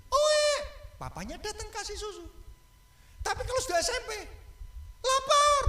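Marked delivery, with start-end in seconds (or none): 0.1-0.5 high, loud imitated baby wail; baby cry. Bayi ndak bisa apa-apa. 5.0-5.6 put-on high, loud child's whine for food; whiny hungry kid. Anak SMP minta makan.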